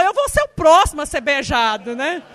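loud, mock-frightened complaint; mock alarmed. Friend pinned to the wall fearing he's next, mock alarm. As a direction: ham up the friend's worry. Friend's scared remark retold.

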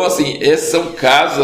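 ordinary talk

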